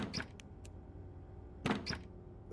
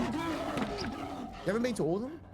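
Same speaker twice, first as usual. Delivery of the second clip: high, drawn-out disbelieving question; incredulous; 12 semitones higher, slower than usual. Real, not acted. Friend questions European who hasn't seen Europe, incredulous. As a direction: echo it back slowly, pitched right up in disbelief.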